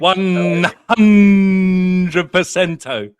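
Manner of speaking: loud, hugely drawn-out catchphrase; bellowed catchphrase. Acted. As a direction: belt it out, stretching every word. Catchphrase bellowed during impressions.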